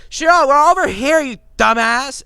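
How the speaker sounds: loud, high angry yell. Acted. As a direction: holler into the darkness. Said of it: "He yells back into the darkness sphere".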